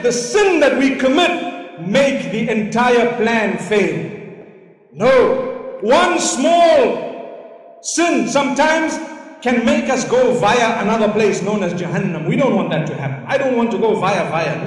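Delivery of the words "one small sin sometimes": "One small sin sometimes" high and very slow; slow warning. Real, not acted. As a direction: drag out the warning. Preacher warning the congregation.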